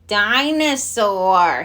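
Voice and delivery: slow growled dinosaur. A narrator's reading. Little brother answering Daddy, slow growl. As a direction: drag the word out in a growl. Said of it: "he growled".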